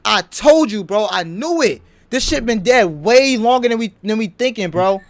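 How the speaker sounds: loud, vindicated rant; hyped rant. Real, not acted. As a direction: lay it out loud like you knew all along. Vindicated, ranting at the stream.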